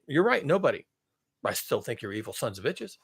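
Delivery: normal talking voice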